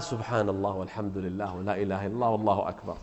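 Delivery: low, very soft murmur of devotion; murmured praise. Acted. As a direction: murmur it privately, as if to oneself. Man murmurs praise at the car.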